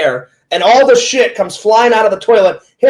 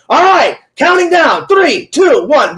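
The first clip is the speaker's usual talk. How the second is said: high-pitched crew member's callout, retold; imitated countdown call; 7 semitones higher, slower than usual. Someone off camera counting down before the launch, imitated calling out.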